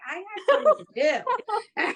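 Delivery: high, laughing mock-serious voice; mock determined self. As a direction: act out your own stubborn insistence, half laughing. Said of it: "and I was like".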